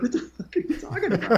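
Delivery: high, laughing mock outrage; outraged disbelief. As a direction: hurl it back at the doctor, laughing. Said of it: "I got pissed".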